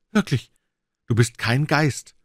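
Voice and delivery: high, slow wondering disbelief; amazed relief. Acted. Watson gripping Holmes's sleeve, high amazed relief. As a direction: marvel that he is real.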